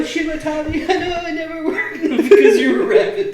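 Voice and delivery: high, laughing squeal; laughing hysterically. Pretend. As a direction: wheeze through the words laughing. Quoting anime, laughing hysterically.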